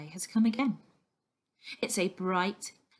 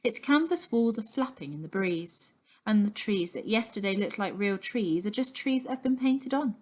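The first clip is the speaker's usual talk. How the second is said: ordinary delivery, nothing marked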